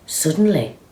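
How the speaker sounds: ordinary delivery, nothing marked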